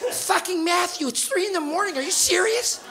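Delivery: high, soft sleepy dad voice; groggy Canadian parent. Retold or imitated. Canadian parent waking to find his son home late, sleepy. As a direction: mumble it half-asleep in bed. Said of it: "You know, like".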